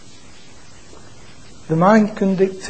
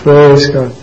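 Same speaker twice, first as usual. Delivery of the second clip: a sudden loud shout of praise; 10 dB louder than usual. Real, not acted. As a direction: call it out to the room. Preacher exclaims praise to congregation.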